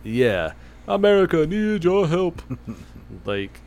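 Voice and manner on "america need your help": game voice line. Pretend. Quoting game's voice line.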